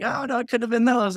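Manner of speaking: high, loud breezy denial; feigned innocent dismissal, pitched high; playing it off. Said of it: "like, play it off like".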